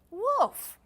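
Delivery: very high, very loud shriek. A narrator's reading. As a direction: shriek it with everything. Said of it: "shrieked Jip".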